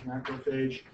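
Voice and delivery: "macrophage" with "ay" in the last syllable, "fayzh"; variant pronunciation. Shown as a demonstration. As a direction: end "macrophage" on "FAYZH", said softly. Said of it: "I've also heard that pronounced".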